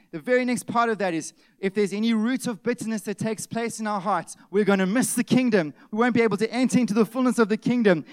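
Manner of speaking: loud, high-pitched pulpit preaching; preaching at full force. Acted. Preacher warning the church about missing the kingdom, loud and urgent. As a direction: drive the warning home to the congregation.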